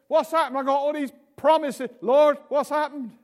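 high, loud cry of a let-down believer; anguished cry to God. Retold or imitated. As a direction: cry out to the Lord in dismay.

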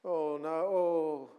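long drawn-out moaning "oh"; grumbling groan. Acted. Preacher imitates us when we begin to grumble, moaning. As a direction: moan it slowly, sorry for yourself. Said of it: "When we begin to grumble".

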